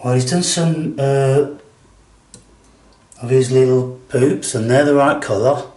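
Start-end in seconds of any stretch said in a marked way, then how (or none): none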